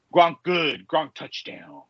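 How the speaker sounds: slow caveman-style jock impression; Gronk. Podcaster mocking what you thought Gronk would be, slow dim impression. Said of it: "exactly what you thought Gronk would be".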